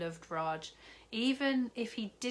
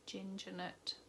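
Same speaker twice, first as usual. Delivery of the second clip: sad; 2 semitones higher, 8 dB quieter than usual. Acted. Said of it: "said Zoe sadly".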